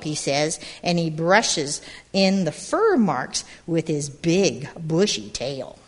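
put-on lively read-aloud to a group of kids; storybook narration. A narrator's reading. Storyteller reading to gathered children, lively narration. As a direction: tell it like a picture book at storytime. Reads picture book aloud.

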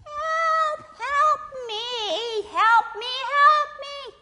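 very high, loud drawn-out wail; crying for help. Acted. Snake trapped in a hole, wailing for help. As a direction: call desperately from far down a hole.

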